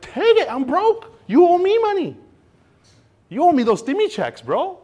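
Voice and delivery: very high, loud squealing taunt. Retold or imitated. Broke owner taunting the state, high mocking squeal. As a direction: shriek it like a mocking cartoon. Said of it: "We're like".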